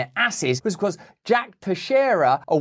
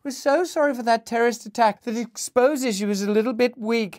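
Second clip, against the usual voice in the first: sarcastic, sugary sorry, higher; snide mock condolence; 7 semitones higher than usual. Mocking Western press offering condolences to Russia, snide higher voice. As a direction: fake sympathy with a sneer. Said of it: "snidey bastard condolences".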